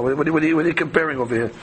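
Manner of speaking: fast sputtering what-what-what, mock indignant; rapid sputtering protest. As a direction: rush out the repeated what like a flustered rabbi. Rabbi's stammering indignant protest.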